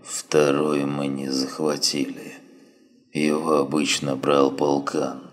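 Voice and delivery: weak, slow, faint dying voice; dying wounded man. Acted. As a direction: barely get the words out, fading.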